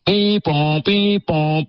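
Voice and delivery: high, sing-song siren noise beep pom; mock donkey-cart ambulance siren; imitated siren. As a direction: play a toy ambulance siren with your voice.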